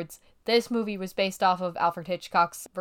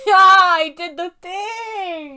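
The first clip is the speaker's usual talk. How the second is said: triumphant; 8 semitones higher, 7 dB louder, much slower than usual. Real, not acted. Gleeful brag mid-podcast.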